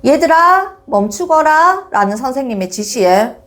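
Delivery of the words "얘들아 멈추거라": lightly acted teacher, higher voice; a hint of a teacher addressing class; teacher's instruction. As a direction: call pupils to a halt. Teacher giving pupils an order.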